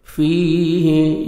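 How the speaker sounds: slow, drawn-out melodic tarteel; Quran recitation. Shown as a demonstration. Tajweed teacher reciting a verse for students, melodic and slow. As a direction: chant each syllable, stretching the vowels.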